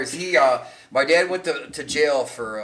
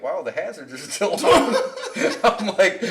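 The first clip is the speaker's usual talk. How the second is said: laughing through the line; amused, laughing; 5 semitones higher, 5 dB louder than usual. Retold or imitated. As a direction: crack up at the sight. Laughing at dad's crash.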